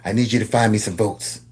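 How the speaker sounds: low, soft scheming voice; a hint of a scheming plotter; mock sinister Trump voice. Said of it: "and was like".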